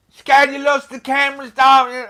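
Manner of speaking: high, loud squealing taunt; mock shriek. Husband imitating wife shouting at him over the intercom, high loud shriek. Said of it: "Lizzie's going".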